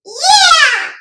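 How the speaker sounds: put-on high, loud kid cheer; joyful shout. A narrator's reading. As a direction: shout it out with glee. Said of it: "shouted Emmett".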